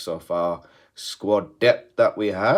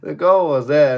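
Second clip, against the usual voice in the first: high and louder, stunned at the miss; exasperated loud repeat; 9 semitones higher, 10 dB louder than usual. Real, not acted. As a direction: hammer home that the goal was open.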